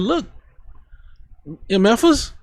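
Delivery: preacher acting out an angry God; heated God voice. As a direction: scold them as the fed-up Lord. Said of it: "I'm getting a little heated".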